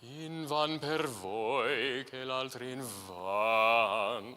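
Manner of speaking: very slow, stretched out. Shown as a demonstration. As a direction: draw it out, let the appoggiatura breathe. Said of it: "just a little more time".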